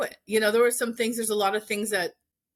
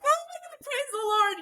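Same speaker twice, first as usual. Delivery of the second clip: overjoyed. Retold or imitated. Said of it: "And I just cried".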